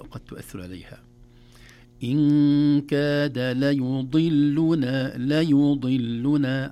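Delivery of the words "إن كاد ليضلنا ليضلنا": loud, very slow tajweed chant; slow recitation. Shown as a demonstration. Teacher recites the verse slowly for beginners. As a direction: recite it slowly, every vowel clear. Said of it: "حركات متتابعة كثيرة".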